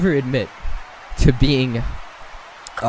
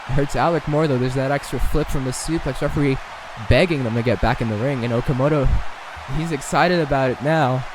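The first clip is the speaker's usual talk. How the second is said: normal talking voice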